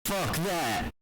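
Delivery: high, loud mock-outraged slogan; shouted catchphrase. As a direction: belt it out to the callers. Rant host's catchphrase.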